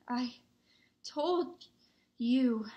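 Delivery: broken, gasped word by word; gasping. A narrator's reading. Hermione scolding her friends after the run, gasping. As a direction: gasp out each word separately, pausing for air. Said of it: "Hermione gasped".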